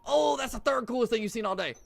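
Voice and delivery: high loud hyped delivery; excited. Real, not acted. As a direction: shout it, hyped up. Hyped joke line.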